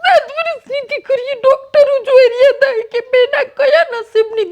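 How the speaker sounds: tearful laugh as the pride wells up; laughing through tears. Real, not acted. Mother tells the visitor about her little daughter, laughing through tears.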